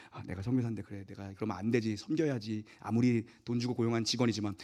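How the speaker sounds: soft, low resigned muttering to oneself; self-talk, resigned. Retold or imitated. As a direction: talk yourself into serving, softly. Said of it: "선교사니까".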